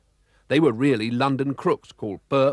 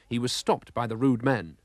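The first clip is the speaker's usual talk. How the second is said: ordinary delivery, nothing marked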